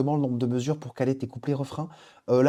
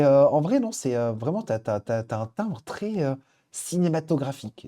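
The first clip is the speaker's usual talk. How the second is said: ordinary delivery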